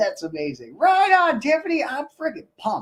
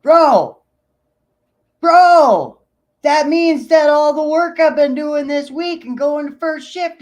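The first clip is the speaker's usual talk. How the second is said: excited shout; 4 semitones higher, 9 dB louder, slower than usual. Real, not acted. Hearing his lead is stepping down.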